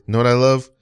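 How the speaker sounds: normal talking voice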